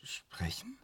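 high, hushed whispered echo; a stunned whispered question; whispered disbelief. Acted. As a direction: breathe the word back in disbelief.

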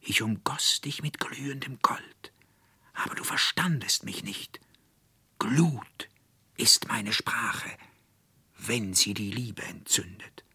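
glowing, hushed spirit voice; whispering sunlight. Acted. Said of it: "der Schein brannte wie in Worten".

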